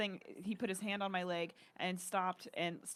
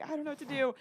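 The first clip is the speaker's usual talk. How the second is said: higher-pitched imitation of crying to mom; lightly acted tearful call; retold tearful helplessness; 7 semitones higher, faster than usual. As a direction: retell a helpless plea, pitched up. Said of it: "I'm just, like, crying to her".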